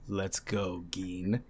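low, quiet, cold villain order; emotionless. Retold or imitated. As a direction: imitate a villain, soft and unfeeling. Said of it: "looks down with no emotion".

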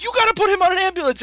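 high, rushed mock panic; mock urgency. Retelling Talia demanding an ambulance, mock urgency. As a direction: rush it out like an exaggerated emergency.

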